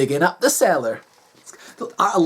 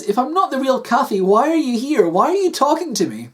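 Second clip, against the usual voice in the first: higher-pitched, insistent wife; questioning Kathy; 6 semitones higher than usual. Acted. Wife demanding answers from husband, insistent.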